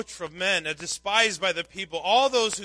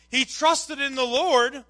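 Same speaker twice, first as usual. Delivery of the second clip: drawn-out, louder taunt, pitched up; ridiculing; 5 semitones higher, 5 dB louder than usual. Retold or imitated. Onlookers mock the sufferer's trust, ridiculing. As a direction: jeer at the believer, starting high and slow. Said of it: "were ridiculing him".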